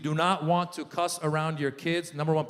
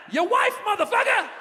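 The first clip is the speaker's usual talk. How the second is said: very high-pitched comeback; high, triumphant punchline; defiant young son; 4 dB louder than usual. Retold or imitated. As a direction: fire back like a cursing kid. Son's final comeback to dad.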